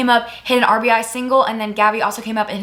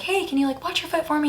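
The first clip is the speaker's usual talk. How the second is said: soft, higher-pitched discreet request; imitated whispering; 7 semitones higher, 6 dB quieter than usual. Catcher quietly asking the umpire a favour, hushed. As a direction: ask it under your voice, as a quiet favour. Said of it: "I was, like, whispering to the umpire".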